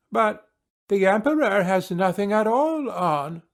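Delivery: put-on high, innocent little child; child's voice. A narrator's reading. Little child in the crowd pointing out the truth, innocent. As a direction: pipe up like a small child.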